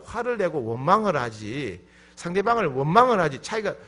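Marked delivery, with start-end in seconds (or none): none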